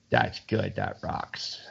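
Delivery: put-on a low, near-voiceless Australian accent rasp; thick Aussie growl. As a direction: mimic a dying Aussie rocker croaking his approval in a deep Australian accent. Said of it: "that thick Aussie accent".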